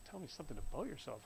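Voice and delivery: incredulous echo. Retold or imitated. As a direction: toss the question back, baffled and rushed.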